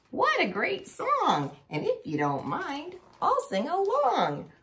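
little bird voice. Acted. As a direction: sing it like a tiny bird. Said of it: "A little bird tweeted".

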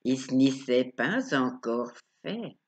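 ordinary delivery

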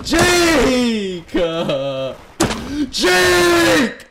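high, loud call for Jake; yelling a name. Real, not acted. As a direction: shout his name across the map.

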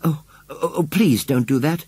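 high, faltering plea; begging. A narrator's reading. Girl begs the farmer to stop prodding, pleading. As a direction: beg him, stumbling on the first words. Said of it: "begged Susan".

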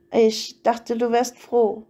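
ordinary delivery, nothing marked

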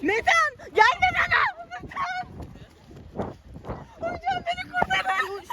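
shrill high mock panic, pitch climbing. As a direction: cry for help as if chased. Metan'dan kaçarken bağırma. (yakalanmamak için kaçarken çığlık çığlığa yardım istemek)

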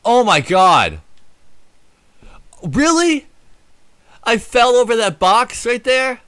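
high, loud, stunned; can't believe it happened; loud disbelief. Real, not acted. Died from falling over box.

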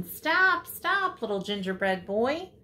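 high, mildly loud calls of alarm; storybook shouting. A narrator's reading. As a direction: call after a runaway, pitch jumping up on the first words.

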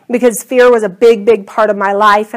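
ordinary talk, nothing marked

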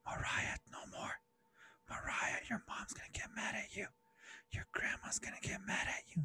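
unvoiced warning whisper; little inner voice. Conscience warning Mariah about her mom, whispered. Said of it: "You hear those little voices".

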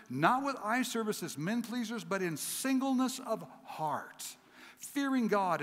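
high, ringing recitation of the verse; fervent scripture reading. Acted. Preacher declaring Colossians to the congregation, fervent. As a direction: proclaim the verse to the church.

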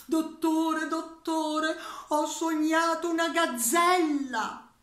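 high, loud urgent plea; anxious patient. Acted. Patient begging the psychiatrist about a dream, high and loud. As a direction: call out to the doctor in a fluster.

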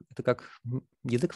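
usual speaking voice

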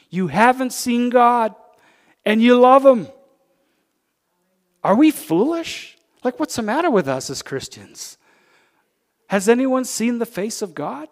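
loud, high preacher's exhortation; preaching at full force. Acted. Preacher rousing his congregation, loud full-force preaching. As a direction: lift the room, preach it out.

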